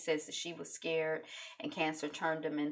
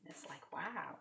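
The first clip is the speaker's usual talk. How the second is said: whispered wow; 13 dB quieter than usual. Real, not acted. Awed aside.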